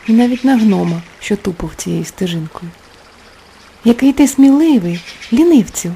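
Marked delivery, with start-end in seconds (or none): 3.8-5.9 high, loud admiring cry; exclaiming. Acted. Girl exclaims praise to the gnome, loud. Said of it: "вигукнула дівчинка".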